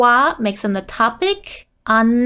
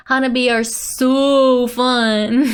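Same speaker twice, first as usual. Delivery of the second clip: "so" stretched out with gleeful force; slow down and lean hard on "so"; excited, drawn-out "so fun"; forceful emphasis; slower than usual. Acted. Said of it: "adding force and emphasis".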